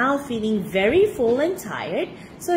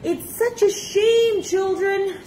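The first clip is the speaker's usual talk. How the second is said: high, drawn-out mock lament; hammed-up sighing apology; sighing regret; 10 semitones higher, 3 dB louder, slower than usual. A narrator's reading. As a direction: sigh it out slowly to the children. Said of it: "sighed Mister Monty".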